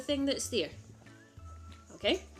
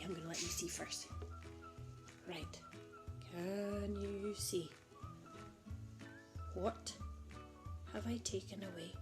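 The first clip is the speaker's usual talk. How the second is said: hushed suspense; 4 semitones lower, 15 dB quieter than usual. Acted.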